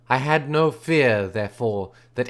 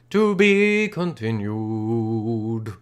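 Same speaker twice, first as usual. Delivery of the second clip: very slow, ominous teaser; slow dramatic announcement; 3 semitones lower, much slower than usual. Acted. Reader teasing the next chapter, slow and dramatic. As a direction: drag out each word like a trailer. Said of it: "cliffhanger sign-off".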